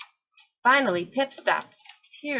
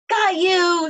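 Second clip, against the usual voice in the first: high, loud triumphant shout; little penguin pouncing on the feather; excited cry; 9 semitones higher, 9 dB louder than usual. A narrator's reading. Penguin chick catching a feather, loud excited cry. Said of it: "she cried".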